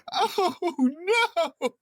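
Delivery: very high cackling wail, hammed up; helpless mad laughter. Hospital patient seeing Batman at the window, acted hysterical laughing. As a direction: act out uncontrollable laughter breaking into words. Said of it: "he starts laughing again".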